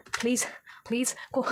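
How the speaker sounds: urgent whispered please to the cards. Real, not acted. Reader whispers to her shuffled cards, begging them to cooperate. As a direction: breathe the words, begging under your breath.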